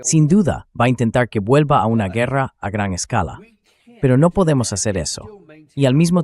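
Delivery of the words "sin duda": normal talking voice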